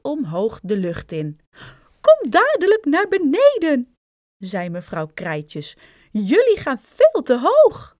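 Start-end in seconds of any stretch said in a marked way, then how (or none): 2.0-3.9 high, loud motherly command; alarmed scolding. A narrator's reading. Mother to sons flying too high, alarmed scolding. 6.1-7.9 high, loud motherly warning; alarmed scolding. A narrator's reading. Mother to sons in the air, alarmed scolding.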